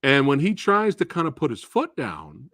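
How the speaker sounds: ordinary talk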